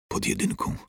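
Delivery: strangled whisper of terror; choked out. A narrator's reading. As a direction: barely force the words out in fright. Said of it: "wydusiła z siebie".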